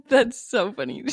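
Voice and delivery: usual speaking voice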